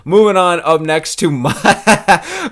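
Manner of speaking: usual speaking voice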